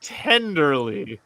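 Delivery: slow, soft storytelling voice; story narration. Acted. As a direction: read it tenderly, slowly. Reading story aloud.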